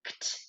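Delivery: bare "kt", two crisp consonants without a vowel; isolated ending sound. Shown as a demonstration. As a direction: sound out "kt" alone. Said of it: "At the end of this word".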